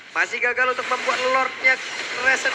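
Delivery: ordinary talk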